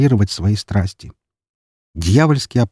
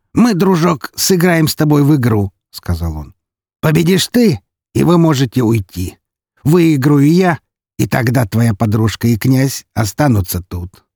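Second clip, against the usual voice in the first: high-pitched theatrical phrases, easing down; playfully menacing count; 5 semitones higher than usual. Acted. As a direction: lay out the rules like a showman villain. (игриво-зловещий голос графа)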